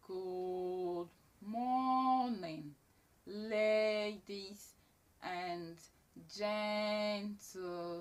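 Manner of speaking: drawn-out, booming, stagey greeting; hugely elongated, forced theatrical delivery; overdramatic elocution. Shown as a demonstration. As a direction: stretch every word out loud and slow like a grand announcer. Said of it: "then it turns to drama".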